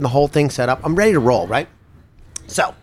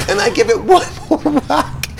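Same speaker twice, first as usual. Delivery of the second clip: laughing mid-sentence, pitched up; 8 semitones higher than usual. Real, not acted. Storyteller laughing while telling the final whack. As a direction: let the laugh break through the words.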